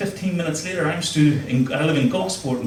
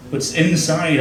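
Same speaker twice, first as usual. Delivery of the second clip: normal talking voice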